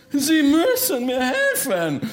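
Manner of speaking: high, insistent outburst; demanding ghost baron. Acted. Baron demands help from reluctant Bertha, insistent. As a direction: insist desperately, pitch leaping up.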